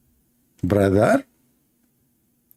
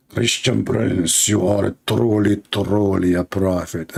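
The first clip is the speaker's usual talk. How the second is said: slow, lower mock-solemn proclamation; solemn angel; 4 semitones lower, slower than usual. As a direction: proclaim it slowly like a heavenly messenger. Acting angel's solemn announcement.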